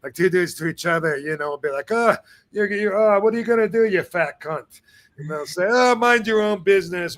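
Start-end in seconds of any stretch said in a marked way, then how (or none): 1.9-4.8 higher, quick playful ribbing; matey banter. Acted. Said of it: "almost a term of endearment". 5.7-7.2 higher-pitched mock-indignant retort; playful comeback. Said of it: "they're having fun together".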